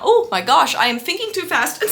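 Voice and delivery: ordinary talk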